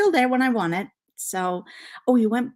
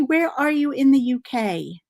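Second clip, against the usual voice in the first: ordinary talk, nothing marked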